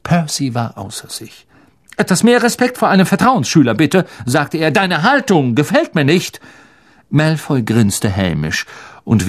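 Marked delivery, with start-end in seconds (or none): 2.0-4.1 high, quick indignant rebuke. Acted. Percy beside himself, demanding respect. 4.7-6.4 higher-pitched reprimand; indignant. Acted. Percy beside himself, rebuking Malfoy.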